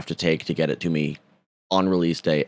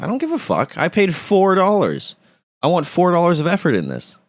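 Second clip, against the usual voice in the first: mock outraged rant; 10 semitones higher than usual. Retold rant at Amazon.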